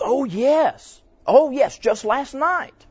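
high, loud, delighted "oh yes", drawn out slowly at the end; eager high woman's voice. Retold or imitated. Pastor imitating the pregnant woman's eager reply, high and loud. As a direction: sound like she's thrilled to be asked.

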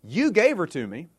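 high-pitched accusation; shifting blame. Acted. Adam places the blame on God, accusing. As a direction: hand the blame straight back, acted. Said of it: "places it on God".

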